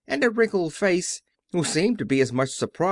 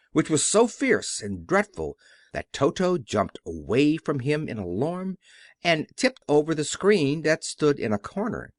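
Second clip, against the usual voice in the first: usual speaking voice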